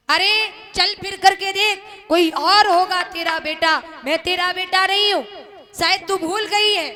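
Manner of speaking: high, loud scornful shouting; angry denial. Acted. Son loudly denying his mother, scornful shouting. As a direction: shove her away with your voice.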